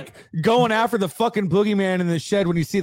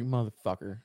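1 muttered curse; 7 semitones lower, 11 dB quieter than usual. Retold or imitated. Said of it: "you're like".

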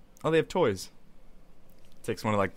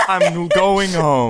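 laughing; 4 semitones higher, 7 dB louder, much slower than usual. Real, not acted. Both laughing, slow.

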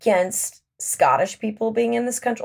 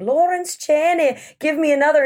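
Scottish brogue; 6 semitones higher than usual. Retold or imitated. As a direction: imitate a host putting on a Scottish accent. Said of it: "in a Scottish brogue".

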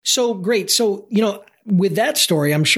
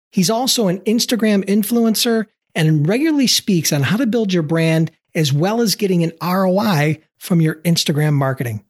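ordinary talk, nothing marked

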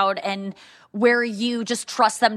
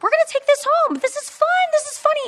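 much higher put-on mom voice, coaxing a child; imitating sister Lauren; faster than usual. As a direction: squeak it up high to sell a scary gift as fun. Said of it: "my sister Lauren was like".

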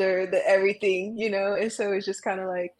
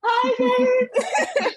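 laughing; 7 dB louder than usual. Real, not acted. Laughing through tears to boyfriend.

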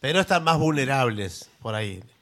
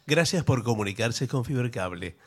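phone menu voice; 5 semitones lower, 4 dB quieter than usual. Pretend. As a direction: read it like an automated phone line. Automated phone line answers.

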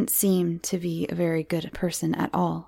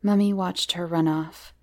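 plain reading voice

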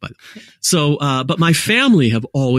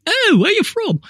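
quizzical local. Retold or imitated. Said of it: "and everybody's like".

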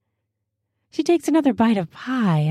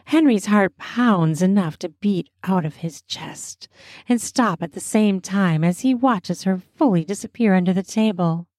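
ordinary delivery

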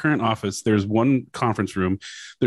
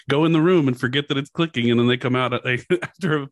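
ordinary talk

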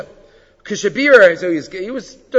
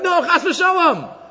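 amused denial; 12 semitones higher, slower than usual. Retold or imitated. Rav Moshe voiced, amused.

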